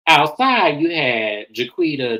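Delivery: ordinary talk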